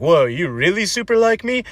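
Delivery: high, squeaky mock amazement; mock surprise. Dater hears a super like back, high mock amazement. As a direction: gush as if the news is unbelievable.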